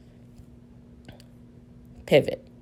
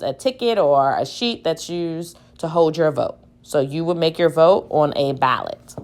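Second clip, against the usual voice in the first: ordinary delivery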